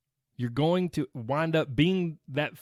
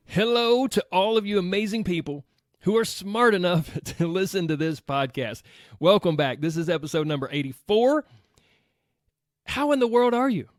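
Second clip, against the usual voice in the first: podcast host intro; 3 semitones higher, 4 dB louder than usual. Acted.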